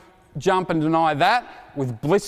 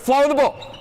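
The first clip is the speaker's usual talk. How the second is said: calling out; 8 semitones higher than usual. Real, not acted. Coach calling out during drill.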